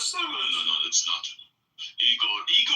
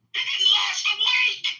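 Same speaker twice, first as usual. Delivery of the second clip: shouting; 9 semitones higher than usual. Real, not acted. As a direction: belt the punchline out. Exasperated punchline.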